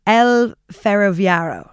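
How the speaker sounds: "El Ferroviario" slowly, with a foreigner's accent; "El Ferroviario" carefully attempted with English-speaker sounds; bad Spanish pronunciation. Real, not acted. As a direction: sound out "El Ferroviario" loud and slow in non-native Spanish. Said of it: "very bad Spanish pronunciation".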